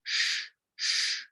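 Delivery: whispered sh, sh; sh sound. Shown as a demonstration. Teacher isolates the sh sound for learners. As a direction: hiss a soft sh sound twice. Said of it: "the S H sound".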